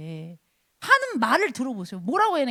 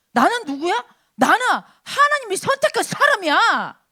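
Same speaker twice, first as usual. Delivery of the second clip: defiant, unshaken challenge, building to a loud declaration; bold David; 4 semitones higher, 3 dB louder than usual. Acted. Young champion answers Goliath before frightened soldiers, bold and unshaken. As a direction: stand up to the giant, pitch climbing. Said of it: "당당하게 흔들리지 않고 딱".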